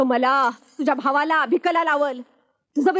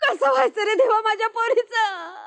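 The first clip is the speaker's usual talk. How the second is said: ordinary talk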